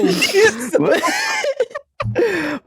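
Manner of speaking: real helpless laughter. Real, not acted. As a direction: laugh hard, almost screaming. Big laugh after story.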